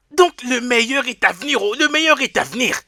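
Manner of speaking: high, loud exultant cries; jubilant. Acted. Servant rejoicing alone over his new fortune, jubilant. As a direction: celebrate out loud, stretching the phrases. Celebrates windfall, dancing.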